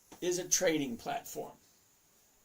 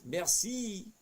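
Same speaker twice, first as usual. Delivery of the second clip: put-on French accent; 6 semitones higher, 3 dB louder than usual. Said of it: "I like your French accent".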